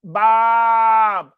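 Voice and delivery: high, loud goat bleat shaped like Bob; bleating goat scream. Retold or imitated.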